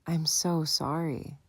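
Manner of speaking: mother soothing her shaken son, slow and tender; tender apology. Acted. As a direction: comfort him gently.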